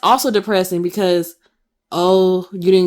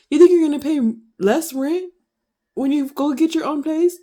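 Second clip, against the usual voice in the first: higher, scoffing mom voice; mocking mom; 8 semitones higher than usual. Son imitating mom doubting he'll pay less rent, scoffing. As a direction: toss it off like a know-it-all mom. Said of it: "my mom loves to tell me".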